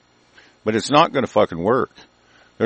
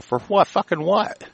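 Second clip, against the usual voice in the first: incredulous retort; 9 semitones higher than usual. Retold or imitated. Retelling his retort to the young firefighter, high incredulous snap. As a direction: toss it back in mock outrage, pitched way up. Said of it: "and I go".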